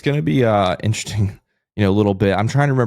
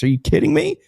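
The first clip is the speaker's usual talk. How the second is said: amazed disbelief. Acted. Co-host hyping the sponsor's numbers to listeners, amazed disbelief. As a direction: pitch jumps way up in hyped disbelief.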